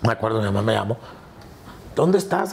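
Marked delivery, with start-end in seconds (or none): 1.9-2.5 higher-pitched imitation of mother; lightly voiced urgent mother; anxious mother. Mother phones her son to locate him, imitated higher anxious voice.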